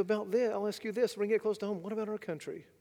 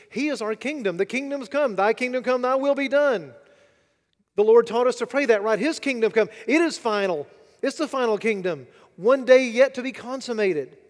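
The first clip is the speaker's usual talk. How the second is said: preaching at full force; 5 semitones higher, 9 dB louder than usual. Acted. Preacher to congregation, full-force preaching. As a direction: hammer the point home to the congregation.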